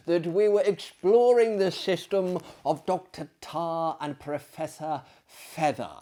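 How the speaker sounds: ordinary delivery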